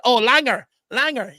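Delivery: Langer said LANG-er, first part stressed; Langer, Cork style, short and punchy; Cork slang word. Shown as a demonstration. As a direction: drop Langer as a Cork insult. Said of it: "County Cork, uh, slang word".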